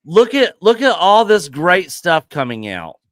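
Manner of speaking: loud, high mock enthusiasm; sarcastic. Real, not acted. Host pretends to cheer upcoming movies, sarcastic. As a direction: gush about it loudly with obvious sarcasm. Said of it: "I'm being sarcastic".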